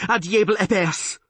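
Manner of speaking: high, loud chattering outburst; teeth chattering. A narrator's reading. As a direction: cry it out through chattering teeth. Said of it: "his teeth chattering".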